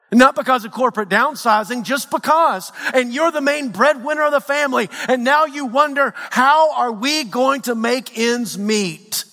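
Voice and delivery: high-pitched, driving sermon delivery; impassioned preaching. Acted. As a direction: build the pain line by line like a fired-up preacher. Preaching at full force.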